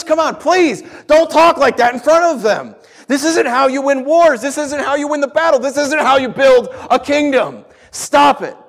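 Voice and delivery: high, loud, exasperated yelling; angry rebuke. Acted. Peter yelling at Jesus in an angry rebuke. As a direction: scold at full voice, pleading and furious. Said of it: "Jesus yells at him. Yells back".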